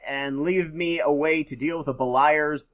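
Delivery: normal talking voice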